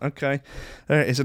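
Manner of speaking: normal talking voice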